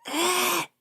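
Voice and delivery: high wail, a playful shriek; mock banshee wail. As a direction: let out a ghostly wail up high. Banshee wail while doing makeup.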